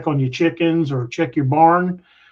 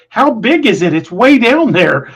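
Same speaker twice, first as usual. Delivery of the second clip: higher, louder imitation of wife; wife peering down the hill, raised voice; wife's question; 6 semitones higher, 6 dB louder than usual. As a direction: voice her curious and raised. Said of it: "She goes".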